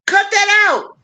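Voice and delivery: playful scolding, voice jumping up high; sharper repeat. Acted. Repeats scolding line, higher.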